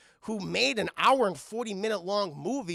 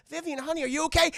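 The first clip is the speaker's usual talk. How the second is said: put-on high, worried check on a child; acted concern; 8 semitones higher, 4 dB louder, faster than usual. Lewis checks on little Vivian at the door, acted concern. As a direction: call to her with careful, pitched-up concern.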